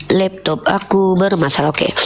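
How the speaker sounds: ordinary talk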